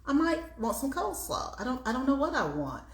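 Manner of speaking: high, dithering self-quote; indecisive inner voice. Retold or imitated. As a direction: act out being torn over food. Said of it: "so I'm like".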